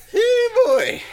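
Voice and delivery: very high, loud Southern call; hammed-up yokel greeting; squeaky Southern holler; Southern hey boy. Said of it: "Deep South".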